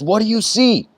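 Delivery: high, firm teacher's question; stern master archer. Retold or imitated. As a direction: ask the test question from above. Master archer asks Arjuna again.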